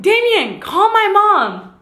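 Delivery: high pleading call, slow; urgent call to boyfriend, high and pleading; urgent call to Damien. Retold or imitated. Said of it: "So I was like".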